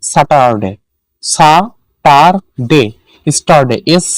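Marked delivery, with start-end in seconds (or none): 1.2-2.8 সা, টার, ডে as three separate beats; teacher splitting Saturday into সা, টার, ডে; syllable by syllable. Shown as a demonstration. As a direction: pause between সা, টার and ডে. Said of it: "যে ওয়ার্ডে তিনটা সিলেবল থাকে".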